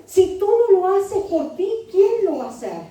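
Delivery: mock self-centred voice. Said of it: "qué dice el feminismo".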